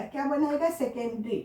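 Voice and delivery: ordinary delivery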